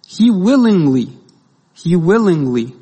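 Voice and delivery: WILLINGLY drawn out and punched, loud at first; emphatic repetition. Real, not acted. Preacher driving home Christ's choice to the congregation, emphatic. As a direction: lean hard on "willingly", slowly, then repeat it.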